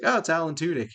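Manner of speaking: high, loud burst of recognition; surprised recognition. Retold or imitated. Fan recognizing the robot's actor, pleasantly surprised. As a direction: spot a favorite, pitched right up.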